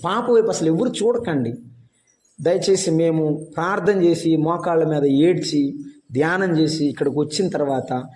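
usual speaking voice

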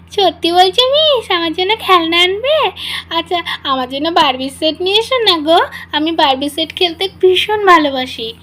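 put-on high, eager child voice begging sweetly; a small girl thrilled about a promised toy; excited little girl. Rimi begging her tutor for a Barbie set, eagerly.

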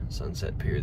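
whispered name, no voice. Shown as a demonstration. Names the restaurant twice.

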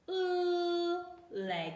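"Oo" sung high and long, then "leg"; oo sound. Shown as a demonstration. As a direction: stretch "Oo" up high before "leg". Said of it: "Why don't you have a try?".

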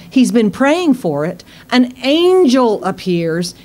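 ordinary delivery

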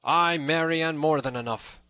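high, loud admission of defeat; loud surrender. A narrator's reading. Beaten Little John answers the tanner, loud surrender.

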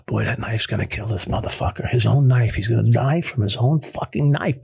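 low, menacing inmate vow; grim, deadly-serious retelling of a threat; intense low threat. Retold or imitated. Ex-inmate retells facing a knife, low and menacing. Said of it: "it's intense, uh, when I really get into it".

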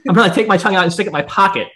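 loud, high, exasperated outburst; comically uncomfortable. Real, not acted. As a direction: squirm out loud, pitch and volume jumping up. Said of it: "I'm so uncomfortable thinking right now".

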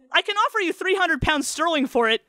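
high, loud blurted offer. A narrator's reading. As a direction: blurt it out in a rush. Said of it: "Schechter blurted".

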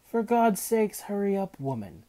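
impatient high shout, dropping on the last word. A narrator's reading. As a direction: yell at them to get moving. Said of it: "Manfred shouted".

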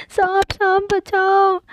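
put-on high, slow frightened child's call for help; little girl begging for help, scared of the snake; scared cry. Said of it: "चिल्लाओ नहीं".

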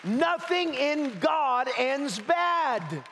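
slow, high, every word weighted; slow declaration. Shown as a demonstration. Preacher setting the line for the congregation to repeat, slow and weighty. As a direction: lay it down slowly for them to echo. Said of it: "Say that with me".

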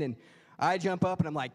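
ordinary talk

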